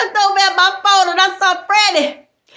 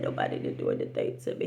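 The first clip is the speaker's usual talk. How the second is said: whispered, much softer; mock-fragile whispering friend; upset friend; 14 dB quieter, faster than usual. Friend hiding her trouble, whispered softly.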